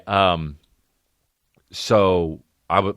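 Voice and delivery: ordinary delivery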